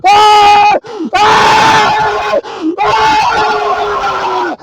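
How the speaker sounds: loud, high full-force screams; enraged screams. Retold or imitated. Uncle and teller fight in the doorway, raging screams. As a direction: scream out repeatedly in rage.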